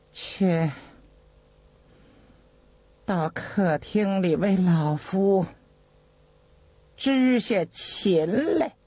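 slow, drawn-out order after a sigh; resigned old master. A narrator's reading. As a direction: give the servant a weary, stately order. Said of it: "长叹了一声， 吩咐身边的张大寿". (长叹后缓缓吩咐)

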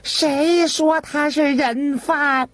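high, slow, sharp protest; affronted high-pitched retort; indignant eunuch. A narrator's reading. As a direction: challenge it in a shrill drawn-out voice. Eunuch disputes the arrest.

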